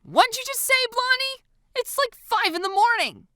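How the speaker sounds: high, loud exasperated protest; frustrated. A narrator's reading. Woken girl to visitor outside, frustrated. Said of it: "fling it open in frustration".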